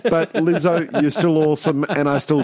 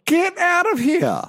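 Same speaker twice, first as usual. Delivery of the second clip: high, slow disbelieving squeal; incredulous; 14 semitones higher than usual. Real, not acted.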